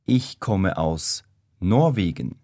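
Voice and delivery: ordinary talk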